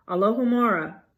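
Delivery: usual speaking voice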